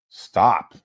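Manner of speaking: ordinary delivery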